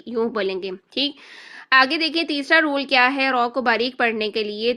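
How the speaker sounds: normal talking voice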